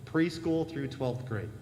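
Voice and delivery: ordinary talk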